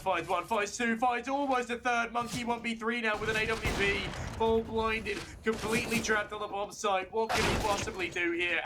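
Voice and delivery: high, rising hype call; excited caster. Real, not acted. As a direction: build the tension up high. Tense one v three, caster builds.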